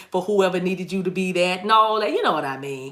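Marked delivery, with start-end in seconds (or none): none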